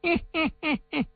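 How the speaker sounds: high, falling hehe sneer; villain's cold mocking chuckle; cold sneering laugh. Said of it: "他嘿嘿一声冷笑啊". (阴冷的嘿嘿冷笑)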